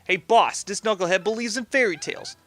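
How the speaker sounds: mock a fellow crook to the boss; cartoon gangster sidekick; mob henchman. Voices henchman mocking another.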